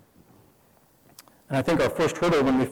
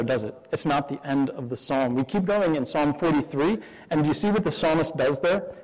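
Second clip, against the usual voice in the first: ordinary delivery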